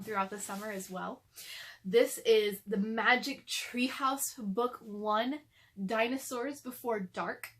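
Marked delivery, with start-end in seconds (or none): none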